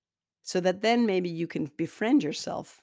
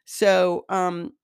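usual speaking voice

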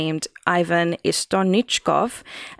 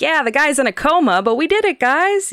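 mock boast; 8 semitones higher, 5 dB louder than usual. Russian officials bragging, mock quote.

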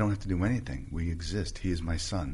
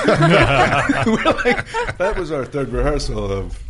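loud, laughing protest; 9 semitones higher, 11 dB louder, much slower than usual. Real, not acted. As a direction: laugh through the words in disbelief.

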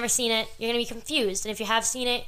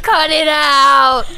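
loud slow yell; 5 semitones higher, 11 dB louder, much slower than usual. Real, not acted. Cohost jokingly yells edit.

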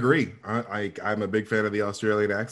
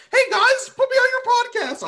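very high, louder mock plea; mock pleading squeal; 4 dB louder than usual. Fan jokingly pitching to podcasters, high mock pleading.

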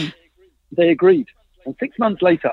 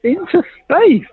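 slow, high, choked-up retelling with a laugh; tearful, half laughing; 10 semitones higher, slower than usual. Retold or imitated. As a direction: relive the tearful launch moment, slower and higher. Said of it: "I started crying".

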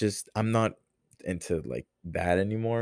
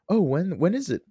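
higher, lightly voiced mom asking; a hint of an eager mom, pitched up; mom's voice; 11 semitones higher, 4 dB louder than usual. Mom texting back about the half marathon, mock voice raised higher. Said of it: "differentiate when it's me and when it's her".

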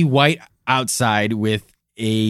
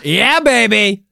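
drawn out and louder; hammed-up catchphrase; 6 semitones higher, 5 dB louder, slower than usual. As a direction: stretch it out, playful. Repeating catchphrase louder, slower.

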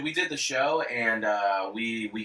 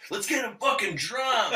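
shout; 10 semitones higher than usual. Retold or imitated. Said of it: "he just goes".

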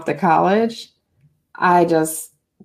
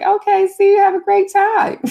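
much higher, bright mock-cheerful send-off; sing-song carefree farewell, pitched way up; breezy goodbye; 13 semitones higher, 4 dB louder than usual. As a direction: chirp it out like nothing ever happened. Breezy goodbye after crying earlier.

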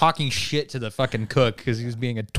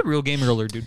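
ordinary talk

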